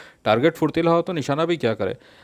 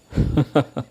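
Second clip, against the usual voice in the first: put-on baby giggle. Baby laughing on rope ladder.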